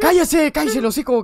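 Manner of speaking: snapping at a noisy teammate; angry shout. Real, not acted. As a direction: shout him down, loud and annoyed. Yells at friend to shut up.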